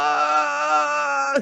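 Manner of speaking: high pushed ah cracking into a cry; wrong way to vocalise; strained ah, air gone. Shown as a demonstration. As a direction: squeeze out the last of the air. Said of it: "No haga, no hacemos esto".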